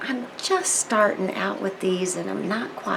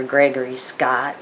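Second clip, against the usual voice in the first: normal talking voice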